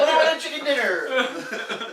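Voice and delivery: slow, high laughing catchphrase; excited. Real, not acted. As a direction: sing out the catchphrase slowly. Playful catchphrase.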